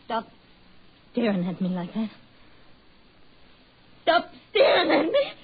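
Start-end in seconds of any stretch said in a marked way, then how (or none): 4.0-5.3 higher, louder, sobbing shout; wife terrified as her husband stares at her, crying out; crying scream. Acted. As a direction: scream it through tears, panicking.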